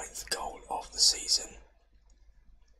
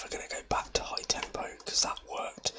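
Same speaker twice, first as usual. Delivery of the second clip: normal talking voice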